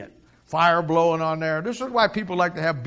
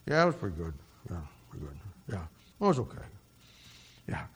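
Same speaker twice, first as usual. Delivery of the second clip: much lower and softer, mumbled; barely bother to answer; ashamed Peter sulking at the table, mumbling; glum Peter; 9 semitones lower, 8 dB quieter, much faster than usual. Acted. Dejected Peter mumbles reply.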